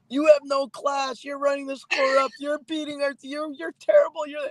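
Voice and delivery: high, put-on voice of a furious hockey mom; angry mother. Hockey mom scolding the coach behind the bench, high angry voice. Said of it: "But she was mad at me".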